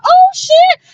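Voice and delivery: a sudden high yelp of surprise; shocked gasp. Retold or imitated. Catching the surprise album link on Instagram, a high shocked yelp. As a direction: scream it like the album just dropped.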